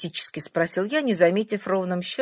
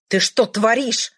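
higher, louder, slow furious yell; furious shout; 5 semitones higher, 7 dB louder than usual. A narrator's reading. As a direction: shout it in a rage. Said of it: "крикнул я в бешенстве". (крик в бешенстве)

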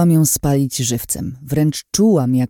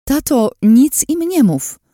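much higher, louder, slower desperate cry; desperate shout; 13 semitones higher, 6 dB louder than usual. A narrator's reading. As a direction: scream a warning to her father. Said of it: "krzyknęłam".

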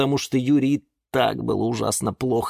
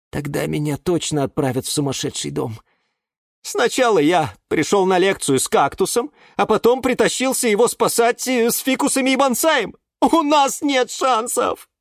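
high-pitched lament; despairing exclamation; 13 semitones higher, 3 dB louder than usual. A narrator's reading. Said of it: "воскликнул он и уронил голову". (отчаянное восклицание высоким голосом)

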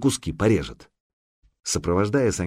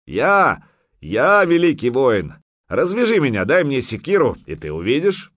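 loud, high, proud warrior's challenge; defiant boast; 6 semitones higher, 5 dB louder than usual. Acted. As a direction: throw it in their faces. Bound Viking boasting, defiant.

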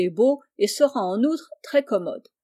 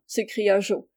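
plain reading voice

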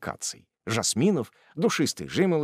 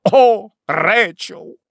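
much higher, louder, slow swoon; passionate cry; 11 semitones higher, 10 dB louder, much slower than usual. Acted. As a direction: cry her name in passion. Tupman passionately seizes her hand.

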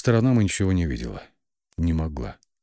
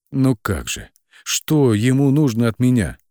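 higher, louder desperate outcry; raised, desperate questioning; crying out; 6 semitones higher, 5 dB louder than usual. A narrator's reading. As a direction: cry out in despair. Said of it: "Закричала".

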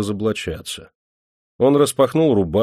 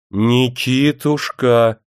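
louder, drawn-out whiny call; capricious raised voice; 4 semitones higher, 5 dB louder, much slower than usual. A narrator's reading. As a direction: raise the voice like a spoiled wife. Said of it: "капризно повысила она голос".

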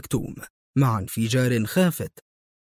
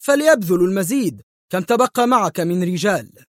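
loud, higher-pitched demand; raised, urgent voice; exclaiming; 6 semitones higher, 4 dB louder than usual. A narrator's reading. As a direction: call it out impatiently and forcefully. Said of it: "هتف مايكل".

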